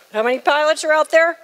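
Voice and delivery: rally the room for a show of hands; calling out to the crowd. Real, not acted.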